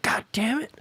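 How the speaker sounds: put-on high, exasperated girlfriend voice; frustrated groan. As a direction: sigh it out, fed up. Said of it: "She's just like".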